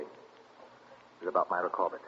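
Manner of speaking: low, soft and hesitant; reluctant admission. Acted. Husband gently telling wife about the execution, reluctant.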